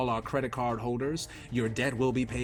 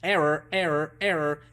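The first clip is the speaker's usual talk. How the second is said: robot glitch; 3 semitones higher than usual. Pretend. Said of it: "I'm not malfunctioning".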